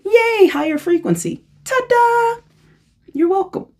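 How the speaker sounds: high, bright ta-da flourish; playful ta-da. Pretend. Joking to viewers after explaining vibration, a high showman ta-da. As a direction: present it like a magic trick.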